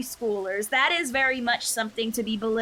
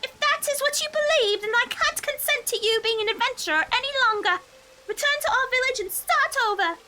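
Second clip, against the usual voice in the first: sister character voice; 13 semitones higher than usual. Acted. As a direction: voice the stern but caring sister, pitched up.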